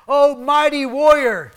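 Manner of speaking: the angel greeting the hiding Gideon, mock grand; mock grand praise. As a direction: crown a scaredy cat a warrior, teasing. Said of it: "that's what the angel is saying here".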